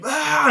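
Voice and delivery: high-pitched lunging "ah"; mock attack cry. Character suddenly lunging onto the actress, high mock attack cry. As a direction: let out a sudden imitated cry. Imitates sudden lunge from silly man.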